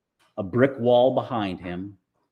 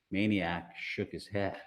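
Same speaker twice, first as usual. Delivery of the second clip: usual speaking voice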